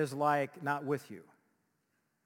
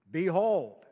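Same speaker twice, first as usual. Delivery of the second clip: put-on loud old-fashioned announcing voice; archaic proclamation; 3 semitones higher, 8 dB louder than usual. Shown as a demonstration. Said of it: "when's the last time you used that word".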